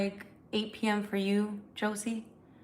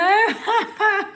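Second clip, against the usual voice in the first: high loud laugh; 12 semitones higher, 11 dB louder than usual. Real, not acted. Laughing at extra time.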